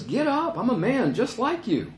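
high-pitched, urgent disclaimer; acted protest. Peter to Cornelius bowing before him, acted protest.

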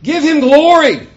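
high, booming proclamation, drawn out; loud scriptural proclamation. A narrator's reading. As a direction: cry it out as a loud command. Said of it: "he said with a loud voice".

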